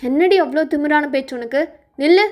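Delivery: loud, demanding interrogation; angry scolding. Acted. Elder demanding where the girl went, angry scolding.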